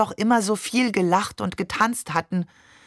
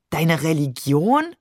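slow, incredulous echo swooping upward; 2 semitones lower than usual. A narrator's reading. Maman doubts her husband's religion, incredulous. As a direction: question it with drawn-out disbelief. Wife scoffs at his religion claim.

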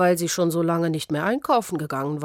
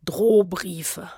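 plain reading voice

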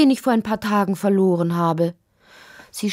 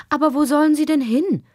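hohe, klagende Kinderfrage; traurig; 11 semitones higher, 4 dB louder, faster than usual. A narrator's reading. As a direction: frag bekümmert und rasch, Stimme weit oben. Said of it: "fragte Lotti traurig". (kindlich betrübt, hoch)

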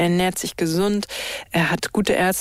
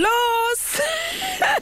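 high bright laughing burst; high burst of laughter. Real, not acted. Trainer laughs after starting race. (hohes lautes Lachen)

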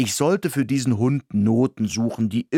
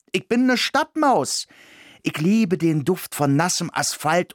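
high, fast, indignant Berlin mouse; proud city mouse; 8 semitones higher than usual. Acted. Berlin mouse refuses the village, high and indignant. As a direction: squeak out your city pride, offended.

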